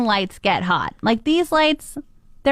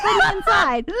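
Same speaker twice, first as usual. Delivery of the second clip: whining dog; 8 semitones higher than usual. Retold or imitated. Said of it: "That was whining".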